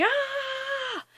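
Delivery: high, soft drawn-out scream; mock pained scream. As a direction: hold back a high scream of pain. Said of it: "painful, and screaming".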